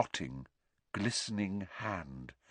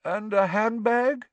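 slow, higher coaxing question; prompting; 13 semitones higher, 5 dB louder, slower than usual. A narrator's reading. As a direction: lead the boy to the answer. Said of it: "prompted Professor Lupin".

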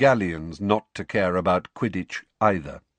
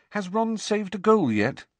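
Hermione voice; 12 semitones higher than usual. A narrator's reading. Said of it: "asked Hermione".

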